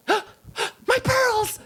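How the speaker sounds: high gasping clutch-your-pearls cry; swooning lady. Acted. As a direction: gasp it like a scandalized dowager. Oyster swooning, gasping aloud.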